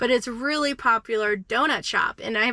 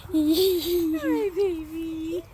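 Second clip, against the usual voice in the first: talking to a dog; 10 semitones higher, much slower than usual. Real, not acted. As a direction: coo at the puppy. Greeting her dog after trip.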